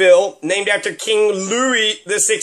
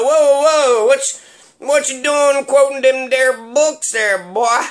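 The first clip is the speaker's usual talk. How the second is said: mock hillbilly; 4 semitones higher, 3 dB louder than usual. Local scolding a man for quoting books, mock hillbilly. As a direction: bark it like a suspicious backwoods local.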